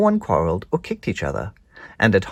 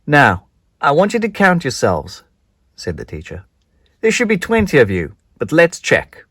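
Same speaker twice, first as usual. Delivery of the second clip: a lightly voiced teacher, a bit higher and louder; 6 semitones higher, 6 dB louder than usual. Teacher telling the children to count themselves, lightly voiced. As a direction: call out to the class like a teacher.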